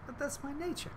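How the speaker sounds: small, high, soft scorpion voice; meek mock excuse. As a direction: offer it softly as a helpless excuse. Scorpion excuses itself to drowning frog.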